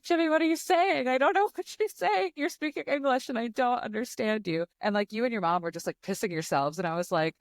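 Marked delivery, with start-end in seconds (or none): 0.0-4.7 much higher, laughing disbelief at Jimmy; retold confusion, higher and laughing through it; mock bewildered plea. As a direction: play your own lost confusion, pitched way up.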